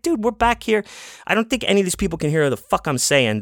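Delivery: high-pitched exasperated arguing; acted-out indignant complaint; indignant protest. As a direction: protest as if wrongly told off. Retold argument with the sound guy.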